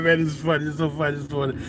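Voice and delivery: normal talking voice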